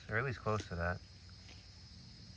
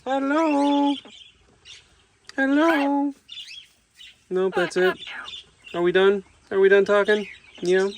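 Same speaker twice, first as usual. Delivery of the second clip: much higher, louder cooing greeting; 12 dB louder than usual. Real, not acted. Keeper greeting parrot Rick, high cooing baby talk. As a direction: coax the parrot to talk back.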